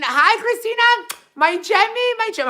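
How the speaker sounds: high gushing hello to a fan in the chat; squealed greeting. Real, not acted. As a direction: greet her like a dear friend who just walked in.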